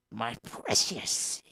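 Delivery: slow, hissing creature voice; Gollum voice. Pretend. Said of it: "Yeah, the Golem voice".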